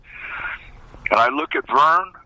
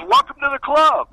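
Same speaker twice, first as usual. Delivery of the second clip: high, loud teasing welcome; imitating a teammate's cheeky welcome; mock welcome; 13 semitones higher, 3 dB louder than usual. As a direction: needle the new guy with a grin. Teammate's mock welcome after spitting.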